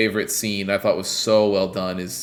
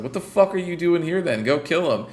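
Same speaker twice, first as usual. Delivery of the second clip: higher-pitched mock leader barking orders; mock Fuhrer; 8 semitones higher than usual. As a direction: play the angry leader lightly. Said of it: "the Fuhrer turns around and is like".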